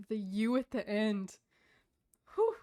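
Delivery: normal talking voice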